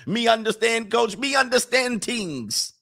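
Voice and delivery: high-pitched fake Jamaican patois; badly faked Jamaican accent, high and drawn out; mock Jamaican accent. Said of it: "I wouldn't get a Jamaican accent".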